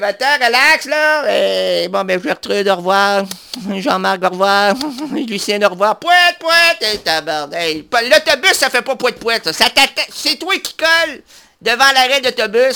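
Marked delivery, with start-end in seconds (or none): 6.0-6.9 silly horn honk. Pretend. Honking like a bus horn.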